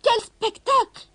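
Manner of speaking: slow, high outraged cry; furious. A narrator's reading. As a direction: drag out the outrage. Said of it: "cria-t-elle furieuse".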